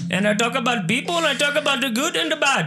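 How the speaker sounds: put-on high, lilting comic Indian accent; host a podcast in a put-on Indian accent; playful Indian accent, pitched well up. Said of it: "my favorite one is Indian".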